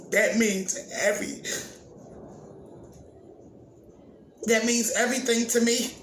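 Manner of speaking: pushing the words out through real tears; emotional. Real, not acted. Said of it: "excuse me for getting, um, a little emotional".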